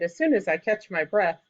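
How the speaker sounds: ordinary delivery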